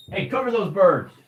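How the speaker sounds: high-pitched call to the next room; real offhand household holler. Real, not acted. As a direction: call across the house without bellowing. Calls out to someone at home.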